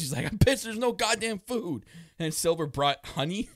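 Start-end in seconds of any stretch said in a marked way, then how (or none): 0.3-1.9 mock angry rant. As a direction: ham up a grumpy complaint about no food. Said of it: "Grayson gets pissed".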